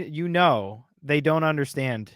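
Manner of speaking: normal talking voice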